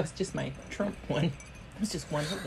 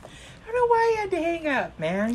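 mock exasperated friend; 10 semitones higher, 5 dB louder than usual. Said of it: "I'm like".

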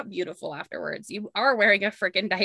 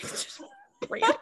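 usual speaking voice

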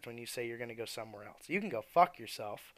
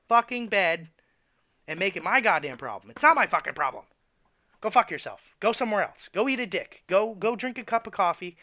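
loud, higher, furious outburst; angry rant; 5 semitones higher, 8 dB louder than usual. Real, not acted. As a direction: let the anger boil over and yell it. Rant building to angry yelling.

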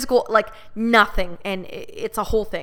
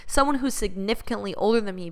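normal talking voice

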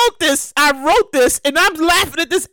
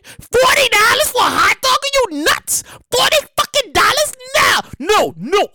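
price outrage; 9 semitones higher than usual. Pretend. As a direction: rant at the crazy price. Shocked by menu prices.

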